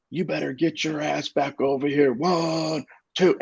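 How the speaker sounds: put-on a drunk father ordering his son back, drill-sergeant style; drill instructor voice. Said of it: "like a drill instructor".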